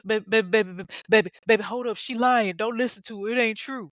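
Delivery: mock-pleading, stammering husband; apologetic. Cheating husband talking down his wife, flustered and apologetic. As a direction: stumble over yourself making excuses. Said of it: "Sultan is all apologetic".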